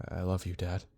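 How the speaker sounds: soft, subdued tenderness; quiet farewell. Acted. Son typing to his dad, quiet and subdued.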